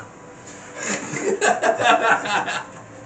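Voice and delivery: airy laugh bursting out; breathy burst of laughter. Real, not acted. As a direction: laugh hard, almost silent. Players laughing at the joke.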